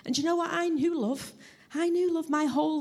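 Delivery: moved, insisting on the love already known; emotional. Real, not acted. Speaker testifying to congregation about love, emotional and high.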